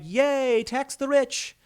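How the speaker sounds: high, sing-song mock of young activists; cartoonish high cheer, ridiculing. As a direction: parody a naive cheering fan. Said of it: "these D S A people are like".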